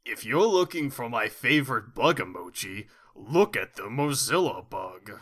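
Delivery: hammed-up video game cartoon voice; swooping, exaggerated Mario impression; [name]'s Hotel Mario voice. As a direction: put on a cheesy Mario game voice. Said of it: "[name] just has the Hotel Mario voice".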